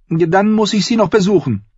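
put-on high-pitched child insisting; high, urgent young boy; insistent boy. Boy wanting to say goodbye to Shireen, insistent. Boy pleading to visit princess.

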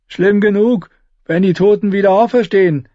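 high, whiny gloomy voice. Acted. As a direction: moan it like a glum squire. Gloomy Ed speaks.

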